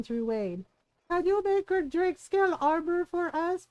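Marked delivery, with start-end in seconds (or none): 1.1-3.7 a higher, lightly hammed-up pestering request; pestering customer. Player harassing the armorers for drake scale armor, mock pestering. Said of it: "now we go harass Aaron".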